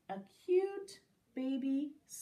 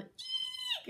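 tiny very high squeak; thin piercing mouse squeal; yell. A narrator's reading. Mouse fleeing the children, high squeal. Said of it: "The mouse yelled".

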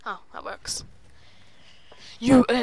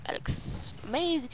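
hushed awe; 9 dB quieter than usual. Real, not acted. Gamer first taking in the sight, hushed awe. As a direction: admire it softly, almost to yourself. First, restrained take of admiring remark.

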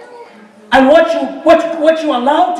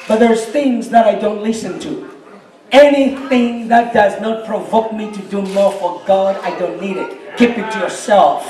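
ordinary delivery

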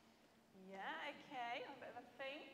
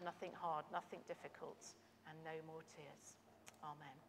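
gentle, low-key spoken prayer; 6 semitones lower, 4 dB louder than usual. Acted. Children's leader praying aloud for the group, gentle prayer.

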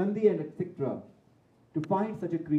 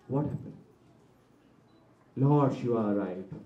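ordinary talk

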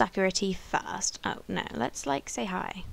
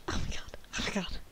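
stunned whisper; 2 semitones lower, 9 dB quieter, much faster than usual. Real, not acted. About to marry in game.

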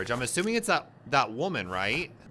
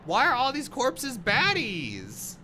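high, drawn-out astonished outcry; slow, high-pitched incredulous exclaiming; loud disbelief; 8 semitones higher, 4 dB louder, much slower than usual. Real, not acted. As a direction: stretch it out in loud amazement. Shocked by corpses in game.

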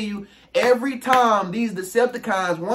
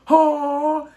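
ordinary talk

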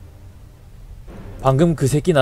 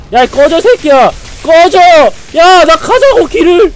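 higher, louder raging shouts; angry yell; 11 semitones higher, 9 dB louder, faster than usual. Real, not acted. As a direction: shout at the mobs to back off. Yelling at enemies. (화난 고함)

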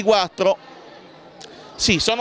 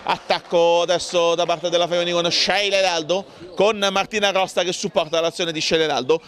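normal talking voice

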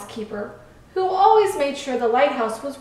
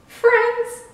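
high, loud, mocking question; scoffing; 11 semitones higher, 5 dB louder than usual. A narrator's reading. Boat mocking the lighthouse's offer, scoffing. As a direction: scoff at the very idea. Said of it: "The boat laughed".

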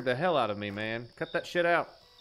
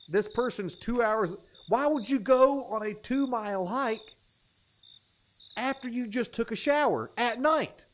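mock indignant rant; 5 semitones higher than usual. Real, not acted. Ranting at the case file.

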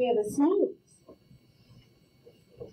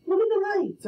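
excited; 11 semitones higher, 3 dB louder than usual. Retold or imitated.

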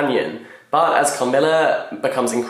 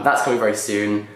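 ordinary talk

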